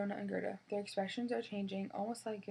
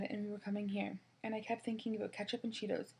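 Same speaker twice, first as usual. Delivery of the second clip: usual speaking voice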